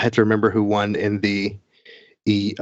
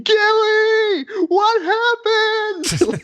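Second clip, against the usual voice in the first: high mock scream, words drawn out long; slow, sky-high screamed impression; Dread's screaming; much slower than usual. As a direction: imitate a caster screaming in shock at a co-caster. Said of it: "he's literally just screaming".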